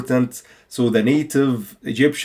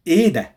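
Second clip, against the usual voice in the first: "إيه ده" asked as a question, silent H; 3 semitones higher, much faster than usual. Shown as a demonstration. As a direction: ask "إيه ده" with a long "ee" and no h. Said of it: "It's a question".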